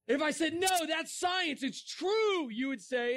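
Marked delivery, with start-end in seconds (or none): 0.5-2.5 high, drawn-out protesting plea; mock insistence. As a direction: insist on it in an exaggerated high-pitched voice. Mock insisting a fairy tale is science.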